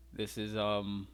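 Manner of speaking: ordinary talk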